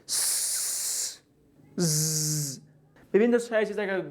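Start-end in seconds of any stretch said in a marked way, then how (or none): 0.1-1.2 س as a soft hiss with no voice; voiceless s demo. Shown as a demonstration. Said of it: "مثلاً". 1.8-2.6 ز with a buzzing voice; voiced z demo. Shown as a demonstration. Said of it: "مثلاً".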